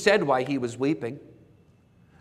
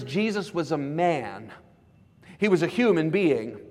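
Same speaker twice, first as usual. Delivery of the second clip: normal talking voice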